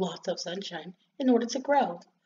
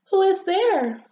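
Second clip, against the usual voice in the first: high, louder, indignant call; annoyed little rosebud; 10 semitones higher, 5 dB louder, slower than usual. Acted. Little rosebud calls to whoever is tapping, annoyed. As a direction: call out higher to the unwanted knocker.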